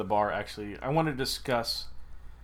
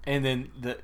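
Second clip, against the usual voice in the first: ordinary talk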